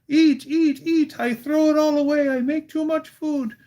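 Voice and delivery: high, loud pleading grandmother; Busha. Retold or imitated. Busha urging full guests to eat, high and insistent. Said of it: "and she goes".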